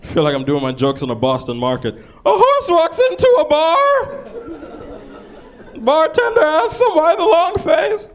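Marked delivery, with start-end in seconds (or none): 2.2-8.1 high, slow mock-cheesy joke telling; high slow sing-song joke delivery; corny joke voice. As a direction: ham up an old groaner.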